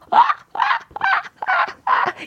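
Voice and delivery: high-pitched squealing seal-like laugh; imitated seal laugh. DJ imitating a listener's seal-like laugh, squealing high. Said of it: "이거 이런 거 있잖아요".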